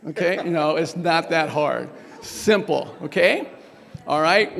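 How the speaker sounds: ordinary delivery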